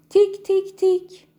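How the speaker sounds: high, loud tik tik tik like a beak on wood; sharp imitated pecking; knocking sound. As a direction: tap it out like a woodpecker. Woodpecker knocking again.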